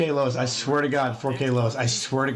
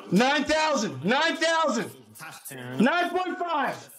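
rising excitement; 3 semitones higher than usual. Real, not acted. Streamer watching nine thousand climb, excited. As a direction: call out the climbing total, pitch climbing.

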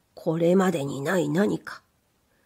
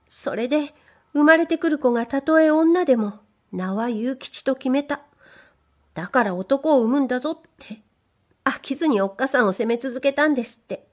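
higher, bashful maid's voice; shy young maid; 5 semitones higher than usual. Acted. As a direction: play the timid young servant girl. Maid continues the story.

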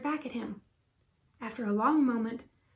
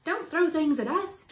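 louder, higher, scolding cry; raised voice, higher and forceful; indignant storybook shout; 5 semitones higher, 5 dB louder than usual. A narrator's reading. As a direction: shout it at a pesky monkey. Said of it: "shouted Annie".

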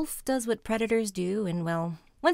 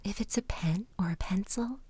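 soft spa whisper; 5 dB quieter than usual. Acted. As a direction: whisper calmly, leading each breath. Guided mindfulness exercise in whisper.

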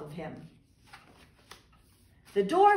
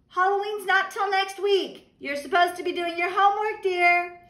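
mother's voice; 9 semitones higher, 5 dB louder than usual. Acted. Mother scolds Froggy.

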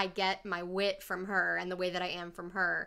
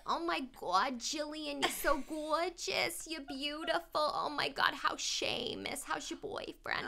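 put-on Bronx grandma accent; 8 semitones higher than usual. Granddaughter imitating her doting grandma, high gushing Bronx accent. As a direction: gush in a much higher Bronx old-lady accent. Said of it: "she talks like this".

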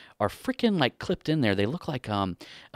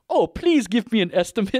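higher, pleading Indian accent impression; imitated Indian accent; 10 semitones higher, 4 dB louder than usual. Customer pleading with the closed shop, Indian accent impression. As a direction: beg politely in a put-on Indian accent. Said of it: "just based on the accent there".

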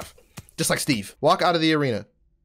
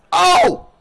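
loud, high shout of glee; excited shout; 10 semitones higher, 7 dB louder than usual. Real, not acted. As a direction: yell it out. Big hit in game.

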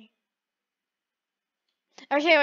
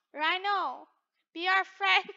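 usual speaking voice

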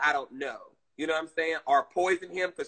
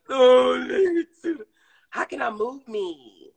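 high-pitched cooing over a puppy; high, adoring pet-owner gush; cutesy gushing; 9 semitones higher, 4 dB louder than usual. Real, not acted. Gushing over her puppy's pictures.

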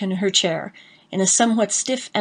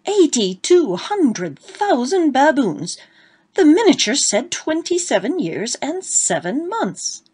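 outraged outburst; 6 semitones higher, slower than usual. A narrator's reading. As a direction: rail at the lie.

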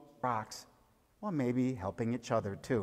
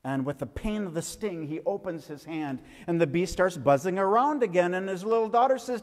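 put-on lively storyteller voice, a little higher and bright for the kids; story for children; 5 semitones higher than usual. Tells children a bee story.

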